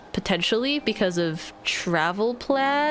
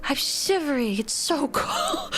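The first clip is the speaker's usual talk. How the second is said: mock shivering Victorian child; pitiful cold child; 4 semitones higher, slower than usual. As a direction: whimper it like a freezing orphan. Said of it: "I'll be like".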